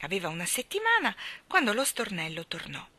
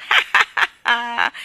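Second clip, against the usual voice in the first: starling's loud mocking laugh and jeer; loud cry; 5 semitones higher, 13 dB louder, much slower than usual. A narrator's reading. Starling jeering from the window, loud high crowing. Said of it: "gridò forte lo stornello dalla finestra".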